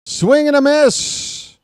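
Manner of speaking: loud, high, drawn-out announcer call; excited strikeout call. Real, not acted. Commentator calling a strikeout to listeners, excited shout. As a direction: belt it out, stretching the words.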